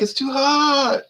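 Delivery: high, slow mock complaint; hammed-up complaining local. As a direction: whine it like a local who hates spice. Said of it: "They're like".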